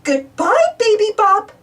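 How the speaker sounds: calling out. A narrator's reading. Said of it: "calls Missus Turner".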